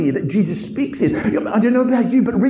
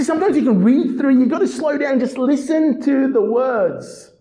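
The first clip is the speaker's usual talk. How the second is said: ordinary talk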